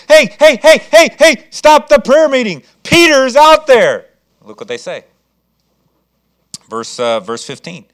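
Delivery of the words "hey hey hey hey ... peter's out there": high loud hey hey, slowing on the last words; excited shouting. Acted. Rhoda bursting into the prayer meeting, shouting excitedly. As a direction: burst in with the news. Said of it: "she's all excited".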